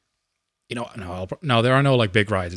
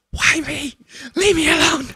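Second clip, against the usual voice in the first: mock girly scream. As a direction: pipe it up high like a scared little girl. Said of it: "screaming like a girl".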